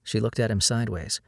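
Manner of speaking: ordinary delivery, nothing marked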